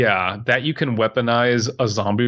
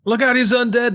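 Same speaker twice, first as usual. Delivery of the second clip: high shouted warning; 12 semitones higher than usual. Pretend. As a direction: shout it as a panicked cop. Mock cop yelling warning.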